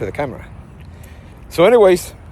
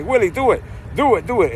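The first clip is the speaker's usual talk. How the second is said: mock urging friends; 7 semitones higher than usual.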